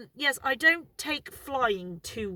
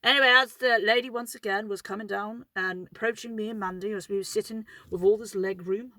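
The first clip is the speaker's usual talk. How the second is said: ordinary delivery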